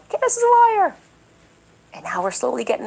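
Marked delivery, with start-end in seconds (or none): 0.1-1.0 very high shrill-free put-on taunt, pitched far up; mock their accusation in a high voice; mocking chorus. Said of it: "They all said".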